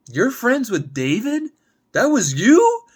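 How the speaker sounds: very high and loud, rising to a shriek; furious disbelief at the man at her door; shocked fury. Acted. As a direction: explode as it dawns on her. Gemma realizes date's betrayal.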